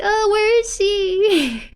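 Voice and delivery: put-on high, slow searching little girl; worried child voice. Child searching for her friend, high slow worry. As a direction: ask it slowly, looking around. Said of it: "So it's like".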